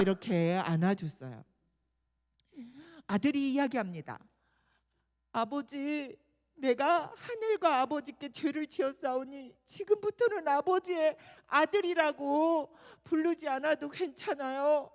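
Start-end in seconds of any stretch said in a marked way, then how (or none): none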